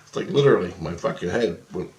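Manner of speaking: ordinary delivery, nothing marked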